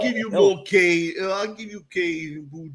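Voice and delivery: playful exaggerated Cajun; mock Cajun accent. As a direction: ham up the Cajun like a TV spoof. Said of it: "they would say".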